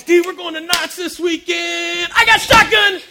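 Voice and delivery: high, loud and eager, ending in a shout; shouting shotgun. Retold or imitated. The shotgun friend calling the front seat before the Knott's trip, loud high shout. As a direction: yell it to claim the front seat first. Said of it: "It's like this".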